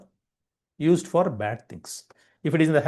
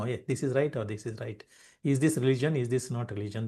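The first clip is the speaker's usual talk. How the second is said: softer, puzzled voice of a confused person; a hint of someone lost between right and wrong; confused questioner; 3 semitones lower, 6 dB quieter than usual. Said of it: "They don't, really don't know".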